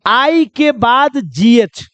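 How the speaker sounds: slow teacher's emphasis on each part; patient slow dictation to pupils; slow, deliberate. Shown as a demonstration. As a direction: spell out आई के बाद GH slowly, one piece at a time. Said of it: "ध्यान से सुनिएगा".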